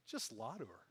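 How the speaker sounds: low, very soft tempting aside; little inner voice. Acted. Teen's inner voice suggests lying to mom, low and hushed. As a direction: slip it in quietly, under your breath. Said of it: "this little voice goes".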